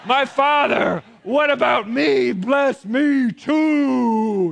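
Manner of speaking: high, drawn-out wailing plea; hammed-up desperate shout, last words stretched; loud begging. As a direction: beg your father at the top of your voice. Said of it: "he begged".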